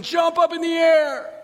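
loud, high, stretched-out words; slow motion. Acted. Angels leaping all at once, slow-motion shout. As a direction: drag it out like a slow-motion replay.

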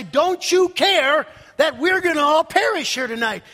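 alarmed plea. Retold or imitated. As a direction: plead loudly like a frightened disciple. Frightened disciples wake Jesus in storm.

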